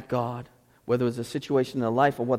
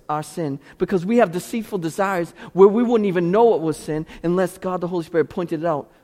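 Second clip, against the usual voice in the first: ordinary delivery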